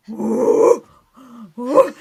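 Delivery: loud straining "woo" puffs; effort puffs. Retold or imitated. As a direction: puff hard with the effort of ripping something off. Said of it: "So I am ripping".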